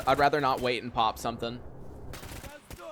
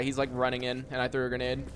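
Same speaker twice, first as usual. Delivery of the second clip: ordinary talk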